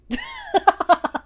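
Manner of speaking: high shrieking laugh at a scary picture; startled shriek of a laugh. Real, not acted. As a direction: squeal with laughter. Reacting to scary Germa image.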